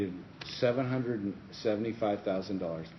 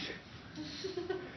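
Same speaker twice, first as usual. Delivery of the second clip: high, soft chuckle; laugh; 11 dB quieter than usual. Real, not acted. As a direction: chuckle at the joke. Laugh after punchline.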